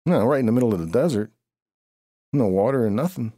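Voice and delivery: shocked cry of no water anywhere; dismayed outcry. Real, not acted. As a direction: blurt it out, stranded in the desert. Dropped off in the desert.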